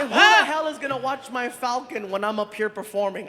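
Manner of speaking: normal talking voice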